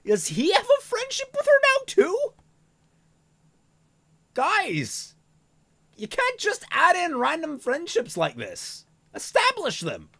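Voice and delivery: high, loud exasperated outburst at the writers; exasperated rant. Real, not acted. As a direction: protest the random friendship loudly. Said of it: "ranting at the writers".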